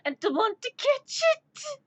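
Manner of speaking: whiny eagerness climbing very high; acting out childlike eagerness; retold excited plea. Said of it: "And I'm like".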